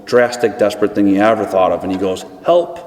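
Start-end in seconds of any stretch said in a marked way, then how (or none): 2.4-2.8 small, hesitant high-pitched help; a reluctant first cry for help from the ditch; timid call for help. Retold or imitated. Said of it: "Took a lot, but he did it".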